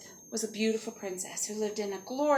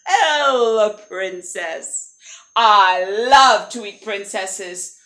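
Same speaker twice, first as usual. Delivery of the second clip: booming, drawn-out dragon greeting; 11 dB louder, much slower than usual. Acted. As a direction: savour it as a hungry dragon, loud on the key words. Dragon greets princess at door.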